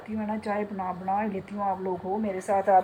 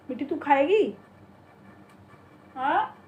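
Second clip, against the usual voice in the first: higher, louder pet-talk question; asking the parrot; 5 semitones higher, 4 dB louder than usual. Real, not acted. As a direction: coax the bird sweetly. Offering biscuit to parrot.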